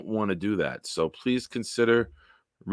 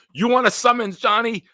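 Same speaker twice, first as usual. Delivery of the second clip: high, loud mock-cop challenge to Johnny; mock threat; 12 semitones higher, 6 dB louder than usual. Teasing chatter Johnny with a mock summons, loud and high. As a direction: bark it like a cop threatening a ticket.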